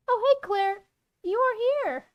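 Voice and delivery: put-on high, swooping girl character; Zoe's voice. Zoe greets Claire on arrival, high voice. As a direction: greet her in a lifted, singsong pitch.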